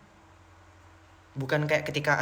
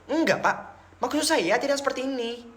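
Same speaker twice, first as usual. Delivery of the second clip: higher, sing-song Madurese-accented Indonesian; 9 semitones higher than usual. Shown as a demonstration. Villager explaining himself to "Pak", thick Madurese accent. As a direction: exaggerate a rural Madurese accent. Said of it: "Madura yang kayak pedalaman banget kalau ngomong bahasa Indonesia".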